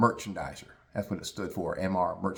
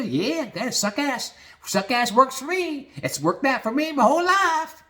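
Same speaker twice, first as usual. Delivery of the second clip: put-on high-pitched suck-up dad lecturing his boys; Bobby; 10 semitones higher than usual. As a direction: gleefully coach the kids in brown-nosing, hammed-up. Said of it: "around the dinner table with Bobby and his boys".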